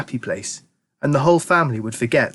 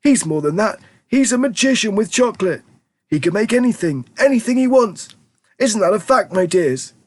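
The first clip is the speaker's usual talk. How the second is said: put-on fond old grandpa; 10 semitones higher than usual. A narrator's reading. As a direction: savour the words like an old grandpa. Grandpa Joe voiced.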